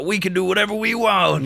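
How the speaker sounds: mock rebel cheer; festival crowd yell. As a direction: whoop it out like a rowdy reveller. Voicing rowdy festival crowd.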